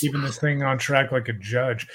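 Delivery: ordinary talk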